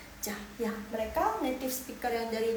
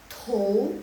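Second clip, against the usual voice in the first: "to" as tou, rising, louder; second tone; 6 dB louder than usual. Shown as a demonstration. Teacher contrasts four tones, rising second. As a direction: slide "to" from bottom to top. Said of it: "Nada kedua".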